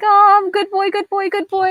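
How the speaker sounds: high giddy excited repetition; flustered squeaky voice. Retold or imitated. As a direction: squeal it like a blushing pup. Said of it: "he's all blushing, like".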